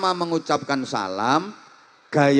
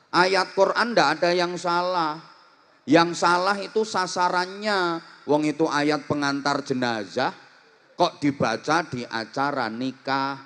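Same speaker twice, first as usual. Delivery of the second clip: usual speaking voice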